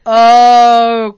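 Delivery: loud, drawn-out "oh" of dismay; imitated here-we-go groan; dreading realisation. Wife seeing husband get irritated, loud dismay.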